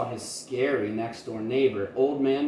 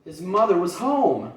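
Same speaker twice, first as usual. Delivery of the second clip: ordinary delivery, nothing marked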